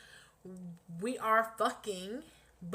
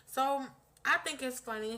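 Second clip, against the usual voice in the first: ordinary talk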